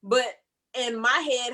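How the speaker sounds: ordinary talk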